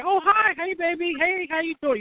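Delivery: put-on high, leering "hey baby" call from a biker; mock catcall. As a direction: play a guy hollering at a passing woman. Biker guys hitting on wife.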